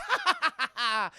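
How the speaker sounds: high, loud evil cackle; villain cackle. Acted. Wormwood laughs at victim.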